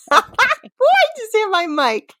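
high and laughing, near tears; laughing through words. Real, not acted. Host laughing helplessly after hitting the mic with her chin.

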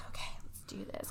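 very soft, voiceless whisper; mock sultry whisper. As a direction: whisper it as a half-possessed come-on. Imitates redhead's possessed sultry look.

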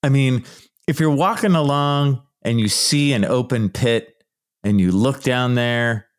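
ordinary talk, nothing marked

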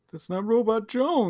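high, hammed-up cartoon robot. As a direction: squeak it like a kid robot. Mocking the cartoon's voice.

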